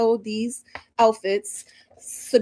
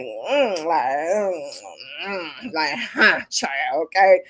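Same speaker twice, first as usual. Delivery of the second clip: lower, giving face with a mm and huh; imitating Miss J; 6 semitones lower, much slower than usual. Doing Miss J's silent judging face, lower voice. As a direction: hum and side-eye like a judge. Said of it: "Miss J was giving a lot of face".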